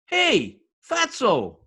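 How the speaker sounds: loud, high taunting call. Acted. Husband calls to the doctor across the street, loud taunt. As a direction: holler a jeering greeting.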